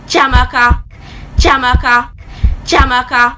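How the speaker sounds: insistent mother's call; mom calling out. Retold or imitated. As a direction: call the child's name sharply across the house. Mother calling child in story.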